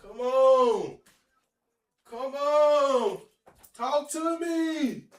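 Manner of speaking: loud, high, drawn-out begging; pleading hype. Real, not acted. Gamer begging his last pack for Giannis, loud. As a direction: plead with the pack for a good card.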